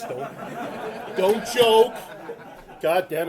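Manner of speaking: loud, high burst at the crowd; mock scolding shout. Comedian scolding the laughing audience, mock-outraged shout.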